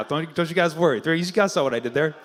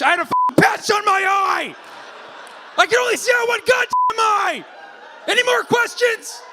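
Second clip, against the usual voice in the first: high, loud exasperated yelling; hammed-up rant, fed up with questions; mock shout; 4 dB louder than usual. Comic voicing the eye-patch guy snapping back at him, mock shouting. Comic voices the patient's angry retort.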